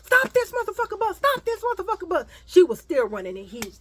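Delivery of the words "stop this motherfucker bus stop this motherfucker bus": high demanding yell; girl chasing the bus, yelling at it to stop. Retold or imitated. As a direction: shout after the bus. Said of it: "still running after that bus".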